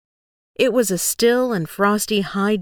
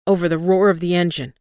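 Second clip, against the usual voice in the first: plain reading voice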